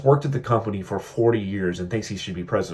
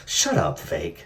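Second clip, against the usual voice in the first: laughing mock retort; 2 semitones lower than usual. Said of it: "he is so annoyed by Vivek".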